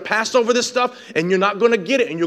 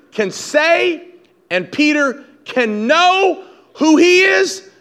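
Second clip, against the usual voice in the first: higher, measured build with drawn-out key words; emphatic preaching; 6 semitones higher, 4 dB louder than usual. Real, not acted. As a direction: preach it in rising, repeated phrases.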